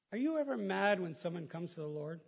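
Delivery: ordinary talk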